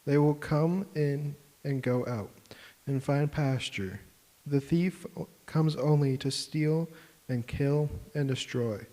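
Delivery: ordinary delivery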